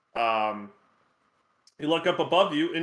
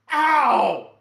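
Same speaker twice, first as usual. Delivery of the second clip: high yelp of pain; 6 semitones higher, 6 dB louder than usual. Acted. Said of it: "He's like".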